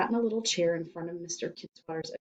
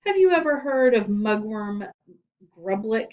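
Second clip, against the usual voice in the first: menacing; 4 semitones higher, 8 dB louder than usual. Acted. Principal menacing.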